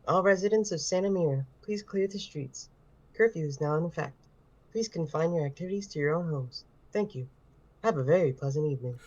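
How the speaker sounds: loudspeaker announcement voice; calm public address announcement; curfew announcer. Acted. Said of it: "The curfew announcer".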